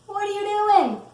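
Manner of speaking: high, loud questioning voice; curious onlooker. Acted. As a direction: ask like a puzzled family member. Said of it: "if somebody in your house asks".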